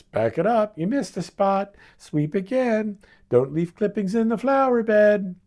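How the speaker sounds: imitating a tough grandmother calling out missed spots; bossy grandmother. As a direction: bark orders like a strict old foreman. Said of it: "she'd call out every missed strip".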